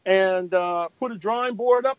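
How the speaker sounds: ordinary talk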